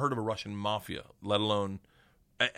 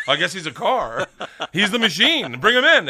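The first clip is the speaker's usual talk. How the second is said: high, loud, laughing mock welcome; laughing mock Russian; 8 semitones higher, 10 dB louder than usual. Russians welcoming the newcomer, laughing and loud. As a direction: laugh it out, waving the guest in. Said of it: "you're like".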